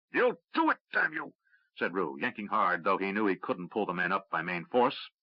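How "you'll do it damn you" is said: high strained cry, forcing him on; fierce urging. A narrator's reading. Rue yanking Luis up.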